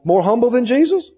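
ordinary talk, nothing marked

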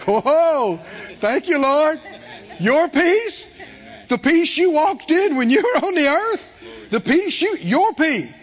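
overjoyed at the gift; amazed gratitude. Retold or imitated. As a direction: Keith marvelling at the Lord's peace. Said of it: "like Jesus said to Keith".

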